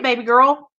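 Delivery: ordinary talk, nothing marked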